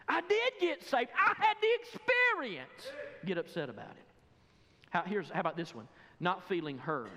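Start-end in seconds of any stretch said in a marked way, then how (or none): 0.1-2.9 high, loud protest; indignant retort. Acted. Preacher insists to doubters he got saved, indignant shout. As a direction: shout it back, drawing out the last words.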